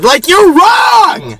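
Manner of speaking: high, drawn-out cry of protest; exasperated outburst. Retold or imitated. Storyteller venting about the guard, exasperated. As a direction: wail it in comic outrage.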